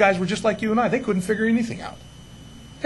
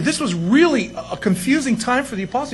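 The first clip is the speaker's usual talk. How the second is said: ordinary talk, nothing marked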